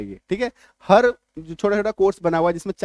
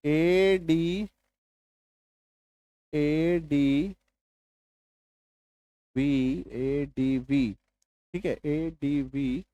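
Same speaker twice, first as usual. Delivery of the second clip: letters ए डी वी drawn out, slow and softer; slow quiet spelling; 4 semitones lower, 5 dB quieter, much slower than usual. Shown as a demonstration. Teacher spelling adv for students, slow and softer. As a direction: spell the letters slowly, softly. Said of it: "फिर देखिए बहुत सारा spelling".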